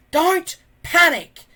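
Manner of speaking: put-on slow, high, loud old man's shout; old man yelling. A narrator's reading. Old Wrinkly yells slowly at the panicking tribe, high and loud. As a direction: bellow it slowly so fools understand. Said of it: "As he yelled".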